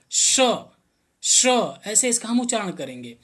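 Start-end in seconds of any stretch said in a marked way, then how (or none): 0.1-1.5 श pushed out with a strong rush of air; forceful श. Shown as a demonstration. Teacher demonstrating the palatal श to students, forceful and airy. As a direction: blast the श out loud, air rushing. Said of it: "हवा बड़ी तेजी से निकलेगा".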